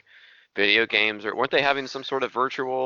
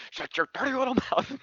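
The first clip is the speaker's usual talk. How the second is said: higher, hammed-up over-the-top scolding; mock scolding parent; 13 semitones higher, 3 dB quieter than usual. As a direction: play a cartoonishly harsh dad. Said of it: "joking mock parent to child".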